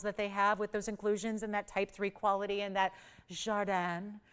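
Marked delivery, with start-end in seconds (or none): 3.3-4.2 jardin said with an attempt at a French accent; French word. Shown as a demonstration. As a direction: try the French pronunciation of jardin. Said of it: "my French accent is not very good".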